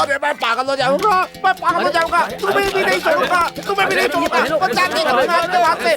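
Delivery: very high, frantic ranting; hysterical meltdown. Acted. Village head storming out of the council, hysterical meltdown. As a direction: go to pieces in a shrieky high rant.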